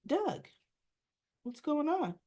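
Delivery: high, startled greeting, mildly acted; caught off guard. Wife to husband who walks in, caught off guard.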